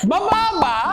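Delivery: asking if someone is getting off; rising call of a driver; questioning call. Shown as a demonstration. Driver asks a passenger if getting off.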